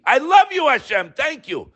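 soaring high, fervent thanks to God; high, fervent outpouring of love; heartfelt declaration. Real, not acted. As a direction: cry it out with love and gratitude. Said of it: "I'll say it publicly".

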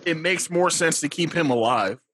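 usual speaking voice

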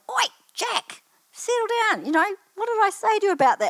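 high-pitched mock telling-off; acted grandmotherly reprimand, high and urgent; retold scolding.

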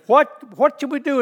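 high, loud, urgent question. Acted. Crowd asking the apostles after Peter's word, urgent. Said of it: "they said".

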